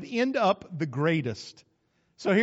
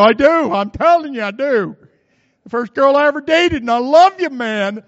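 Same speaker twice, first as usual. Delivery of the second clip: much higher, louder hammed-up insistence; insistent shout; 7 semitones higher, 14 dB louder than usual. Husband loudly insists to his girlfriend that he loves her. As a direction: protest it loudly, almost shouting.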